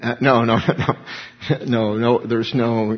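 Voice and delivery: usual speaking voice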